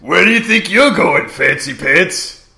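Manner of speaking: mock taunt, slowing right down on the insult; Largo impression. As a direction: sneer at him like a cocky little villain. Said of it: "that's nothing at all what Largo looks or sound".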